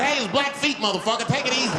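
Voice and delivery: high, offended correction; high indignant protest at the chief; indignant squeak. Retold or imitated. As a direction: snap back at the chief, high-pitched.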